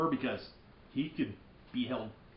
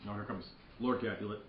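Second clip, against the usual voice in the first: ordinary talk, nothing marked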